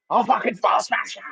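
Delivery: high, drawn-out mock threat; slow, strained, high-pitched menace; mock aggressive threat. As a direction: imitate a jailhouse aggressor. Acted everyday jail threat.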